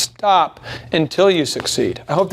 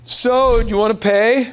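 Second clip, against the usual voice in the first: high, tentative mock-awkward question; hesitant pay request raised high; weird awkward ask; 5 semitones higher, slower than usual. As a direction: play the salesman who's embarrassed to ask for money. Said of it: "Doesn't that sound weird?".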